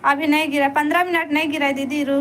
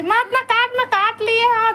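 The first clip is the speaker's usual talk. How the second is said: sudden higher-pitched telling-off; scolding protest; 6 semitones higher than usual. Real, not acted. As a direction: protest at being bitten, pitch jumping up. Scolding child for biting.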